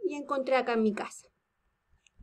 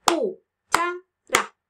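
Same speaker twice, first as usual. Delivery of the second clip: "Cuchara" raised and loud, cu, cha, ra; "Cuchara" in three slow separate beats; syllables with claps; 4 semitones higher, 5 dB louder, much slower than usual. Shown as a demonstration. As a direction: punch each syllable of "Cuchara" apart, as if clapping. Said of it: "¿Y si aplaudimos?".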